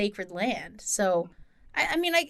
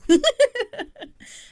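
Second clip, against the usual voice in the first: embarrassed laugh; 9 dB louder than usual. Real, not acted. Laughing after admitting she cried.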